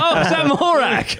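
high, giggling disbelief; laughing mock question. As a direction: ask it through laughter, high. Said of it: "They're like".

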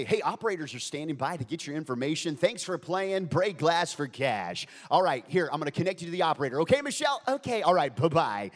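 loud radio host sign-off patter; upbeat announcer closing out a call. Acted. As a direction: wrap up the contest briskly on air. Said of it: "I'd put on my D J voice".

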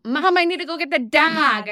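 put-on high, loud child with Midwest vowels; Midwest accent. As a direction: call out dog with wide vowel. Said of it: "Noelle will go".

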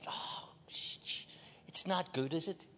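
breathy whisper, very slow; mock whisper. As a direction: whisper it as if ashamed of your reflection. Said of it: "and we said".

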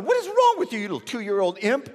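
loud, high-pitched scolding outburst; mock-furious telling-off of a toddler; exasperated dad. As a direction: imitate a father about to snap. Acting exasperated father about to snap.

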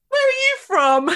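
very high, loud nosy question; imitating tipsy mum. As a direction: blurt it at the delivery driver, hammed-up. Imitates drunk mum quizzing delivery guy.

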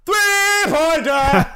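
high, very slow mock arena cry; stretched announcer call. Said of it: "Så han fick sträcka det".

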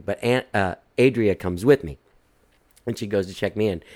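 normal talking voice

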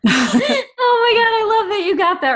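high, loud burst of delight; delighted squeal. Real, not acted. Thrilled the co-host got the reference, delighted squeal.

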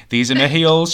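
loud and high, showing off; proud boast. Retold or imitated. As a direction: boast it loudly like a proud mum. Mum showing off her heels.

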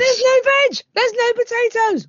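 high, loud indignant yell; incredulous, pitched right up; outraged outburst. Real, not acted. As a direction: shriek it in disbelief at the price. Outburst at the absurd price.

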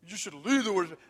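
hammed-up bossy demand; mock loud insistence. Church member telling Janet to lead worship, mock loud insistence. Critics pressure Janet, retold.

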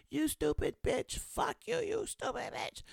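imitating a woman cursing, high-pitched and slow; angry rant. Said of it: "going off on someone".

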